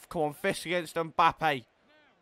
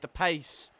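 ordinary talk, nothing marked

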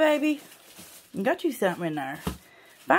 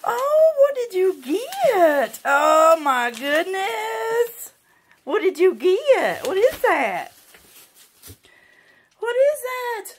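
excited pet talk; 6 semitones higher, 3 dB louder, slower than usual. Real, not acted. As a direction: gush over the dog's find. Dog found toy, delight.

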